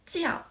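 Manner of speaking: "降" starting high and falling sharply; fourth tone. Shown as a demonstration. Teacher names the falling tone, "降" pitched high then falling. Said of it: "The fourth tone is deciding".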